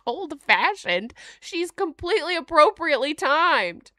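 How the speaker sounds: outraged defense; indignant. Real, not acted. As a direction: protest in disbelief. Indignant at wiki wording.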